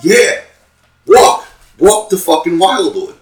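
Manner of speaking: loud, high mock shout; shouting her out of the car, retold; retold yell. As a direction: bark it at her.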